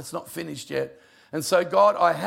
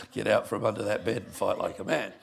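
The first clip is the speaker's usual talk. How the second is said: a wife teasing her husband through laughter; laughing mock order; 8 semitones lower, 4 dB quieter than usual. Wife laughing as she repeats her teasing line. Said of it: "Yes, my husband's on his hands and knees".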